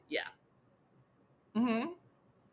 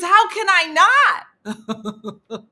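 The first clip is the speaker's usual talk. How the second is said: loud, high, drawn-out retort; exasperated; 9 semitones higher, 9 dB louder, much slower than usual. Retold or imitated. Partner unable to believe she's joking, exasperated. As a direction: imitate his incredulous outburst. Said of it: "his exasperation".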